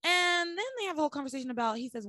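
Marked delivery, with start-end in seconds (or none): none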